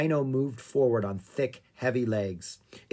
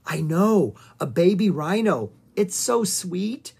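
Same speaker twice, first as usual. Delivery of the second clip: raised pitch, slowing into a coo; delighted gushing; 5 semitones higher, 4 dB louder than usual. A narrator's reading. Annie gushes to Speedy about the baby rhino, delighted cooing. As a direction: gush and then draw it out.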